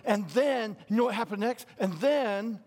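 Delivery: put-on eager, excitable child storyteller; excitable kid. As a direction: imitate a child bursting to say what happened next. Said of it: "Kids sound like that".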